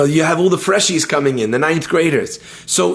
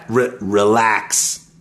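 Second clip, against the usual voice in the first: stretched out slowly and low; slow relaxed; 9 semitones lower, much slower than usual. Shown as a demonstration. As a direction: drawl it out like you're unwinding. Acting out relaxing.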